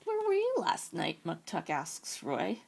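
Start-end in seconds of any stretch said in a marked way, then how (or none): 0.0-1.2 lightly voiced bear, high and curious; a young bear asking, pitched up. Muktuk the bear questions Roy, high and curious.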